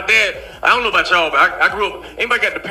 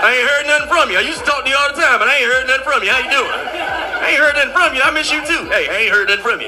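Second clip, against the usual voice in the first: mock needy dad; 5 semitones higher than usual. Dad repeating, mock whiny, higher.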